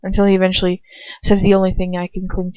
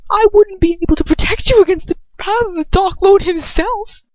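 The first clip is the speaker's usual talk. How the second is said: raised, bitter man's voice; bitter Lucius; 9 semitones higher, 3 dB louder than usual. Acted. Lucius scorning her plan, bitter and raised.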